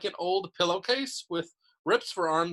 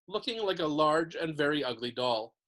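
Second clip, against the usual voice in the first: usual speaking voice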